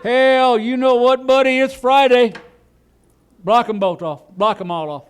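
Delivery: high, loud country holler easing off; hammed-up foreman giving crew orders; road foreman. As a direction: holler it out like a rural road boss. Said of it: "some road foreman every night".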